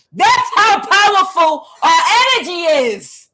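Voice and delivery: loud, high shout of power; a big high triumphant cry. Real, not acted. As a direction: shout it out at the climax. Climax of the prophecy.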